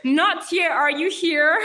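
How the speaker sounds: high, loud roll call. Real, not acted. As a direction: call the name out across a crowd. Calling roll for an absent viewer.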